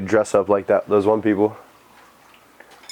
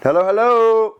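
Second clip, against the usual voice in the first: high loud call; 10 semitones higher, 4 dB louder than usual. Real, not acted. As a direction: call into the dark. Calling into basement.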